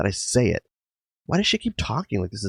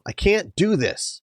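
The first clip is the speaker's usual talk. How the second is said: slow, much higher echo of the cry; remembered outburst; 10 semitones higher, slower than usual. Retold or imitated. Narrator hearing her own outburst again, slow high echo. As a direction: replay the cry slowly, up high. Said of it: "Too loud, too raw".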